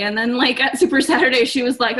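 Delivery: ordinary talk, nothing marked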